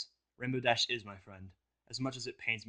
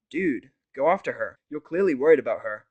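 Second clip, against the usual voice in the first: urging; 4 semitones higher, 12 dB louder than usual. Acted. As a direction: press him to go. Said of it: "Vanel urges me".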